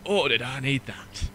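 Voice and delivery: put-on slow, relieved sigh in a Yorkshire accent; slow Yorkshire relief after the first sip of a pint; northern accent impression. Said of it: "an impression of it".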